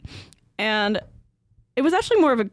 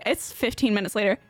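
usual speaking voice

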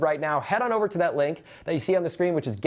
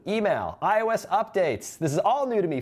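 ordinary talk

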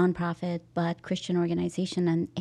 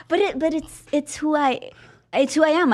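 laughing; 7 semitones higher, 6 dB louder than usual. Real, not acted. Laughing about her swearing.

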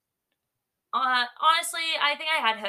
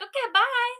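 sweet bye; 13 semitones higher, 4 dB louder than usual. Retold or imitated. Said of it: "And then she was like".

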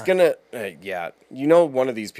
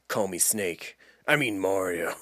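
Kurt Russell voice; 5 semitones lower, 7 dB quieter, slower than usual. Retold or imitated. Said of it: "Mario should be Kurt Russell".

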